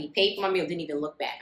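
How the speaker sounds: usual speaking voice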